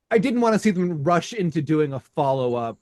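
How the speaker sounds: ordinary delivery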